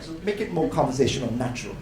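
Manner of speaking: usual speaking voice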